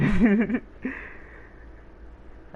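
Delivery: high-pitched laughing burst; real laugh. Real, not acted. Gamer laughing after telling how he almost died lifting, high laugh.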